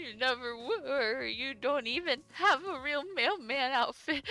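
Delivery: high, slow, wavering fake sob-talk; mock crying voice. Player voicing the sobbing liar to Dave, mock crying. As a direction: blubber the lines like a hammed-up crybaby. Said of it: "That's me crying".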